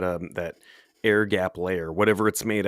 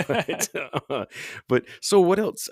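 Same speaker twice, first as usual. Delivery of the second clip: laughing; 11 semitones higher than usual. Real, not acted. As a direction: laugh it off and push on. Laughing after accent joke.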